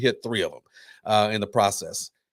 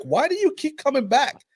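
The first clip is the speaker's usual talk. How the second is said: high, exasperated plea; a mock bewildered cry, pitched way up; imitating the women. The Indian women asking the drunk woman, high and exasperated. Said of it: "they're just like".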